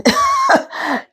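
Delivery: short high laugh at her own stumble; a quick embarrassed laugh; laugh at herself. Real, not acted. Laughing after failing to say evidentiary. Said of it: "it's not working".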